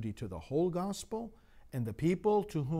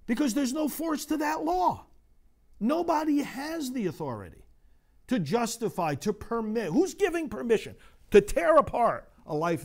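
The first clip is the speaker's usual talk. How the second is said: higher, louder, mounting pulpit indignation; preaching at full force; 10 semitones higher, 5 dB louder than usual. Acted. Priest preaching hard to his congregation, impassioned. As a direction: drive it home to the congregation, rising. Preaching against legal abortion.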